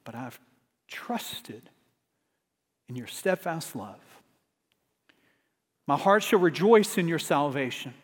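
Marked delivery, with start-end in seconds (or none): 0.0-4.0 hushed prayer. Acted. Reading a psalm's closing prayer.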